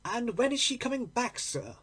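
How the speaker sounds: lightly voiced landlady asking. Landlady asks about lodger.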